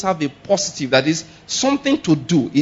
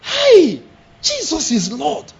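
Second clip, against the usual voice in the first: high yelp then slow drawn-out praise; high shocked cry, slowing down; startled exclamation; 10 semitones higher, much slower than usual. Shown as a demonstration. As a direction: jump in shock then slowly declare it. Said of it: "to say everything".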